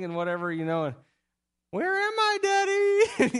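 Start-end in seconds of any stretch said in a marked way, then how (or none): 1.7-3.4 put-on much higher, playful small child voice; a giggling kid under the covers calling out; little boy hiding. Son hiding under covers asks his dad, high playful child voice.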